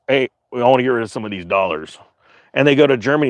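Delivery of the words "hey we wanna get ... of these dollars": voicing France. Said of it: "France goes".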